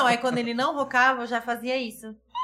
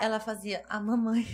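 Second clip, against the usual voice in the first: usual speaking voice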